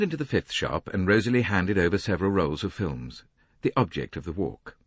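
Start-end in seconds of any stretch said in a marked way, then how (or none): none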